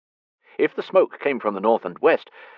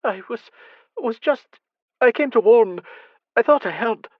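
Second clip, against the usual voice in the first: broken, higher, rising stammer; building, louder halting fright; faltering words; 6 dB louder than usual. Acted. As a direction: she can't finish a sentence. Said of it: "Words failed her".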